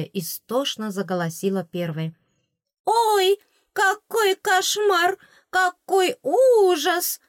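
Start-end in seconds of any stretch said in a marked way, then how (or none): 2.8-7.2 very high, loud, slow wail; high drawn-out fairy lament. A narrator's reading. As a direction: lament over the horror in her mouth. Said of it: "запричитала она".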